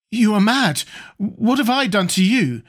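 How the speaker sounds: high, alarmed protest. A narrator's reading. Acted protest at gunpoint.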